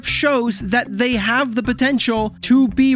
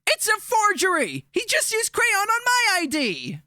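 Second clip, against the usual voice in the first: higher, much softer, from far off; muffled protest; 8 semitones higher, 5 dB quieter than usual. Acted. Trapped host protesting the fake ID, muffled and high. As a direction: object from behind the door.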